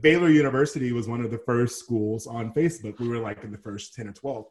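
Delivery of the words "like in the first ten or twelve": normal talking voice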